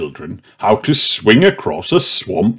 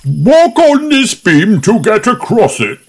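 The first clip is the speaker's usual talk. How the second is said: Grampy Rabbit's voice; 13 semitones higher than usual. A narrator's reading. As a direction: present it like a grand showman. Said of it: "says Grampy Rabbit".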